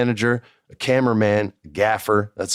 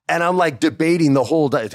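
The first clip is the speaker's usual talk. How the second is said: ordinary talk, nothing marked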